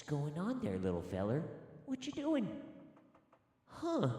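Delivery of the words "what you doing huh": put-on squeaky voice to a child; high silly voice. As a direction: ask it in a high cartoon voice. High voice to the kid.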